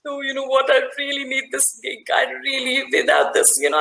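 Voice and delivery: pleading guilt-trip. Acted. Coach imitating a needy person guilt-tripping a giver. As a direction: lay the guilt on thick, mock desperate. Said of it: "there is a unsaid emotional blackmail".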